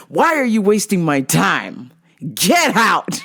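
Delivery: imitating an irate man, building to a shout; mock angry. Rude practitioner throwing clients out, mock angry. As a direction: punch it out, ending in a mock yell.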